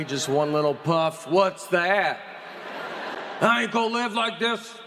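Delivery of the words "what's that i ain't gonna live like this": mock furious wife. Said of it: "my wife was furious".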